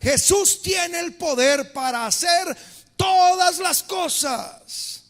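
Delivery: loud, slow preaching crescendo; preaching at full force. Real, not acted. Preacher to congregation, shouting slowly and strongly. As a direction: proclaim God's power, building to a shout.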